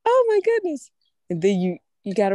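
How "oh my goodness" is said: high, loud cry of relief; joyous exclamation. Retold or imitated. Preacher voicing a believer's joy at deliverance, exultant. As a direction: burst out in delight at the rescue. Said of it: "you be like".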